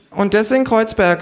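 a dry mocking jab; kangaroo. The kangaroo mocks the rich hostess, high and sarcastic.

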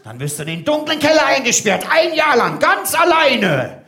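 loom over him with a hammed-up threat; mock menacing threat. Interrogator threatening the old man, hammed-up and loud.

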